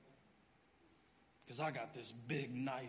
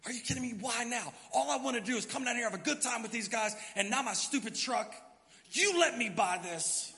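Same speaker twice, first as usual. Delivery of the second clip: loud, high complaint to God; hammed-up whining rant to God; mock angry prayer; 7 semitones higher, 6 dB louder than usual. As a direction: rant at God like a spoiled kid. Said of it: "Here's what you say".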